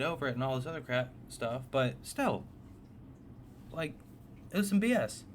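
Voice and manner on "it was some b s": indignant. Real, not acted. Still angry years later.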